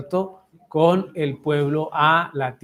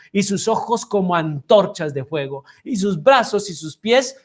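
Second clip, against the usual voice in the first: a raised, solemn reading of the verses; scripture reading; 3 semitones higher, 4 dB louder than usual. Acted. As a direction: read the passage out to the class like a sermon. Reads the Daniel passage aloud. (lectura solemne de las Escrituras en voz alta)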